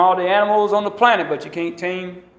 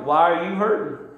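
rising scolding of his own knee, higher each line; 5 semitones higher than usual. Shown as a demonstration. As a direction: rebuke the knee like a child, building up. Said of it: "And I say it just like that".

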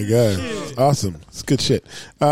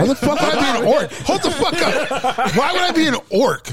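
laughing disbelief; 8 semitones higher than usual. Real, not acted. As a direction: draw it out in amused outrage. Mock outrage at orc casting.

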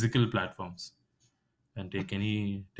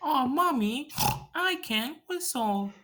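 much higher, slow whiny little-girl cartoon voice; Peppa Pig voice; slower than usual. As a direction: whine it high like a cartoon piglet. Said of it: "she goes like".

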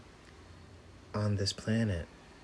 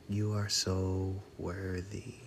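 guided affirmations. Acted. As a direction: let each line land with long pauses.

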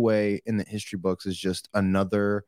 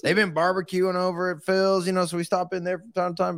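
mock guy chat in a raised pitch; East Coast guys' small talk; 7 semitones higher than usual. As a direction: put on the guys' idle catch-up, pitched up. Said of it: "they start talking about what they've been doing".

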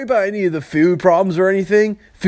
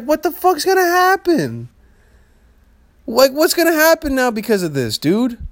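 high-pitched worried exclamation; paranoid; 7 semitones higher than usual. Retold or imitated. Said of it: "It makes me so goddamn paranoid".

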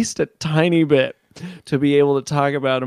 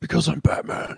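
Batman voice; 5 dB quieter than usual. Retold or imitated. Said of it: "Batman quote".

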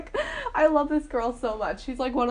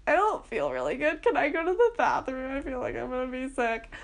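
faking sick; 5 semitones higher than usual. Retold or imitated. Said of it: "Which was not true at all".

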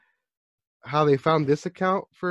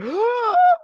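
mock gasp; 7 dB louder than usual. Mimicking hyped fans.

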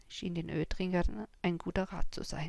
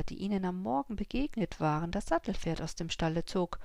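plain reading voice

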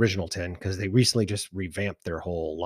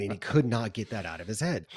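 ordinary talk, nothing marked

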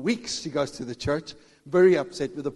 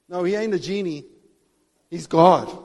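normal talking voice

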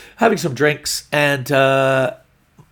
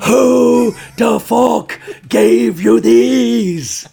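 loud, high, long-drawn mock yell; furious girlfriend; 10 semitones higher, 8 dB louder, much slower than usual. Girlfriend waking comedian with red underwear, furious mock shout. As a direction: scream it word by word, hammed up. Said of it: "She was really angry".

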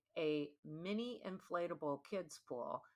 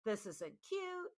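higher, a lightly voiced fed-up girlfriend; voicing the girlfriend; 3 semitones higher, 6 dB louder than usual. Girlfriend telling her boyfriend, higher and lightly exasperated. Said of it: "obviously this chick is like".

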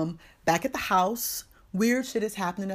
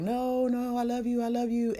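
high whiny begging, run together; mock lovesick pleading; 6 semitones higher than usual. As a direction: plead in a put-on high lover's whine. Lover refusing to leave, retold.

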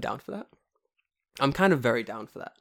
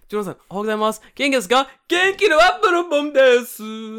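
high, loud goofy rustic voice, slowing at the end; silly mock-dialect greeting; made-up dialect; 12 semitones higher, 10 dB louder than usual. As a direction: ham up a nonsense country Japanese. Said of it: "My Japanese gonna be like that".

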